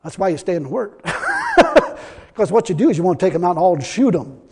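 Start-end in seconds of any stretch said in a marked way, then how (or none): 1.0-1.9 laugh. Real, not acted. Laughs after joke.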